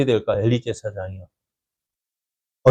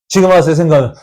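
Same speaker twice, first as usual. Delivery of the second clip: ordinary talk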